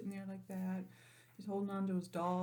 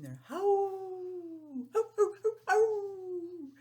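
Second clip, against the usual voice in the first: high drawn-out mock howl; coyote howl; 12 semitones higher, 8 dB louder, much slower than usual. Teacher howling for the children like a coyote.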